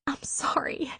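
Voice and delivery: a tearful, hushed whisper. A narrator's reading. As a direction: breathe the apology out softly. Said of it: "she whispered".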